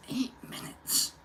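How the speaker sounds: whispered eight minutes in dismay. Real, not acted. Host reacts under his breath to the viewing stats, whispered. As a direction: whisper it to yourself, stunned. Muttered to himself.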